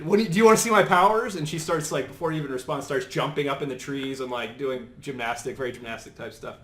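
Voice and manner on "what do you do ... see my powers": fast, high, loud eager offer; excited. Acted. Squirrel Girl offering Iron Man a demonstration, excited. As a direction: rush it out like a keen teen showing off. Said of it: "Very excited".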